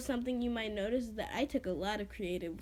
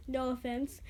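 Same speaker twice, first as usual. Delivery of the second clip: ordinary delivery, nothing marked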